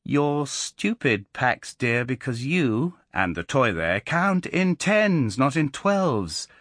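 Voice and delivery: put-on slow, gloating child's verdict; a small boy proving his mother wrong, slow and triumphant. A narrator's reading. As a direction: spell it out slowly, savouring the win. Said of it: "he said slowly and triumphantly".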